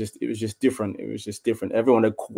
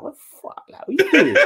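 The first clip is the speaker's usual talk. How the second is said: high, incredulous laughing outburst; shocked disbelief. Retold or imitated. Coach bursting out laughing at the player, shocked high squeal.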